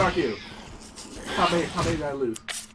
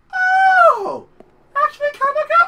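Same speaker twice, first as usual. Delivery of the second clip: much higher, loud surprised squeal; pleasantly surprised; 9 dB louder than usual. Retold or imitated. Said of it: "I was like".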